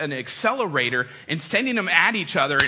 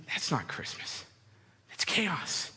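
softer, hushed and appalled; disapproving hush; 2 semitones lower, 10 dB quieter, slower than usual. Retold or imitated. Orderly family judging the gift chaos, softly appalled. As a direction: murmur it in stern disapproval.

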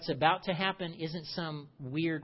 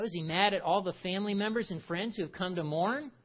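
usual speaking voice

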